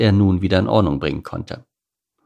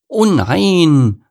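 put-on loud, high child's protest; high, raised wail of disappointment; dismayed cry; 9 semitones higher, 7 dB louder than usual. A narrator's reading. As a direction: cry out like a let-down kid. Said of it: "rief Lukas".

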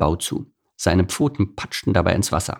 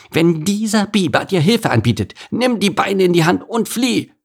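high, urgent small-animal voice; warning squirrel; 11 semitones higher than usual. Acted. Squirrel warns the opossum about the beaver, urgent. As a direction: warn them emphatically, pitched up. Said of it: "winkte dem Opossum warnend zu".

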